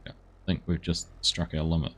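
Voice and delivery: normal talking voice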